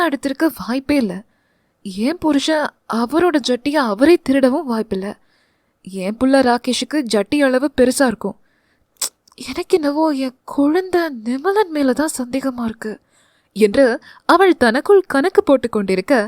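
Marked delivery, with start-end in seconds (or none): none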